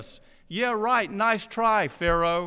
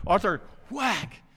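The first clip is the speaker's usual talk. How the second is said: sword whack. Retold or imitated. Sword blow sound effect.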